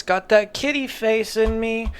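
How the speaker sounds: normal talking voice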